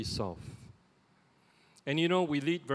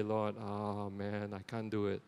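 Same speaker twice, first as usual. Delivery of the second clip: weary sigh; 5 semitones lower, 6 dB quieter than usual. Retold or imitated. Voicing a worn-out prayer.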